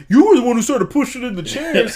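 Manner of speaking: high-pitched mock-indignant comeback; indignant retort. Wrestler answering the one who called him coward, mock-indignant retort. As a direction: throw the blame back, hammed-up and high. Retelling wrestlers' petty squabble.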